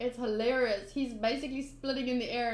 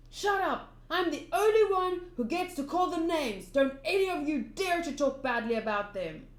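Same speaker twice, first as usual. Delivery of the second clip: higher at first, then settling, indignant; angry scolding; 3 semitones higher than usual. Acted. Mayor silencing townspeople about the pirate kid, scolding. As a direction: scold them with a sudden outburst.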